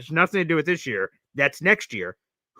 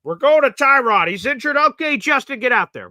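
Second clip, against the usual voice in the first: mock coach call; 8 semitones higher, 7 dB louder than usual. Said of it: "That's just sort of weird".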